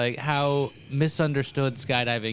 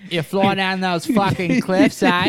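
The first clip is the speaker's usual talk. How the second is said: put-on Australian accent; 6 semitones higher, 5 dB louder, slower than usual. Mocking Australians asking wingsuit questions.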